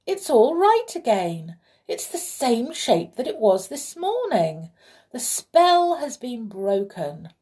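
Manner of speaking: high, loud, amazed relief; crying out. Acted. King seeing the spell broken, crying out in relief. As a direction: exclaim it high and overjoyed. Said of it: "he cried".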